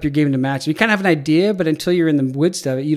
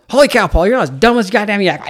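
put-on louder and higher, grinning like a kid at Christmas; coach's excited quote; 5 semitones higher, 5 dB louder than usual. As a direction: blurt it out with delight, a bit faster. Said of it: "and he goes".

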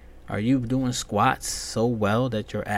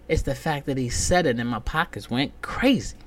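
ordinary delivery

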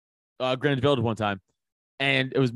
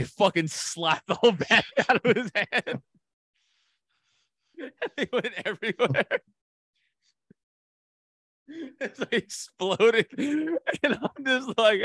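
high-pitched, laughing through the words; can barely get it out for laughing; 10 semitones higher, slower than usual. Real, not acted. Comic laughing to his cohost while recounting the prank.

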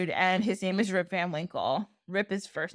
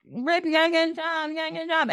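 higher mock nagging wife, singsong; wife nagging her lazy husband, pitched up and mocking; 8 semitones higher, faster than usual. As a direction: whine it up high like a pestering wife. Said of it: "So she was always like, / nagging".

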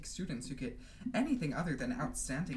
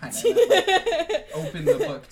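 laughing loudly through the words; 16 dB louder, slower than usual. Real, not acted. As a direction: laugh out the line. Laughing while talking.

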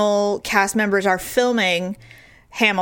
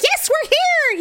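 excited disbelief; slower than usual. Retold or imitated. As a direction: gush with amazement. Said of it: "I'm like".

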